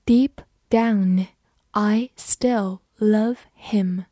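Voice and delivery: slow, word by word, for students to follow; slow reading. Shown as a demonstration. Teacher reads the sentence slowly for students. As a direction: read it slowly so learners can repeat. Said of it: "好，慢慢来读".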